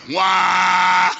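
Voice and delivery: hammed-up infant cry; imitated baby wail. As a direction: wail like a baby waking at night. Baby crying at two a.m.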